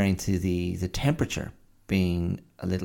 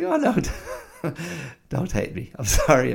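high-pitched mock dismay breaking into a laugh; playful, raised-pitch apology; retold pleading; 8 semitones higher, 4 dB louder than usual. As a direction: imitate a parent begging forgiveness. Parent pleading with upset child.

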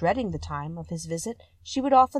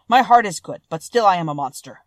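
lightly voiced Beast, louder and emphatic; a hint of a rueful monster, strongly projected; 10 dB louder than usual. A narrator's reading. Beast admits to Beauty he is monstrous, lightly voiced and loud.

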